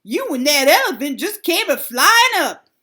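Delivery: put-on loud cackling old crow; mocking old crow voice; elderly voice. A narrator's reading. Old crows mock.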